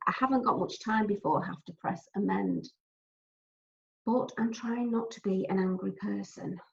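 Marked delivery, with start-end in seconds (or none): none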